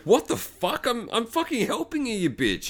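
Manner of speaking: high, put-on indignant protest; mock indignation. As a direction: imitate an affronted rescuer. Hunter's indignant reply.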